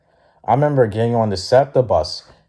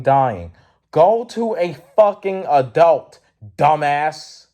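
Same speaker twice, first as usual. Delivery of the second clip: raised pitch, emphatic scolding; exasperated rant; 8 semitones higher than usual. Retold or imitated. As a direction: tell him off, stressing word after word. Acted angry rant back at beggar.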